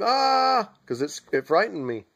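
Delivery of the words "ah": a high, sharp mock scream of fright; retold scream. Man reliving being torn into the sky, high frightened scream. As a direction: shriek as if yanked into the sky. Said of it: "I began to scream".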